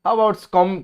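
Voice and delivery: usual speaking voice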